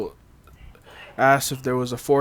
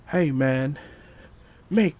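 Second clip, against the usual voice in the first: half yell it like a hippie driver; mock yell; slower than usual. Host quotes Stan Lee's hippie driver cameo, mock yell. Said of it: "that yells out".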